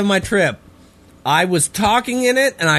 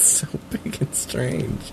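whisper; 3 semitones lower, 9 dB quieter, slower than usual. Retold or imitated. Whispered echo of clip.

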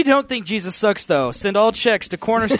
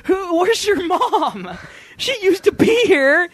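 laughing; 8 semitones higher, slower than usual. Real, not acted. Laughing mid-chaos.

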